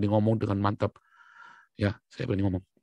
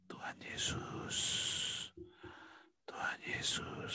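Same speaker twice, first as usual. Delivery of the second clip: slow, soft whisper; whispered prayer to Jesus; 15 dB quieter, slower than usual. Shown as a demonstration. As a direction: breathe the name reverently. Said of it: "Bisikan namanya".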